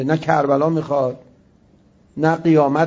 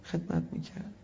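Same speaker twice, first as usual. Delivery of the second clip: whispered, unvoiced; whispered awe; 14 dB quieter than usual. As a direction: breathe it out in a hush. Whispered reverent remark.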